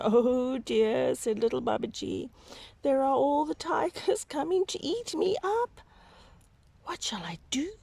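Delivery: put-on high, frightened little boy voice; high and panicky, dropping to a whisper at the end; frightened child. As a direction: squeak it out, scared of the tigers. Babaji frightened by tigers.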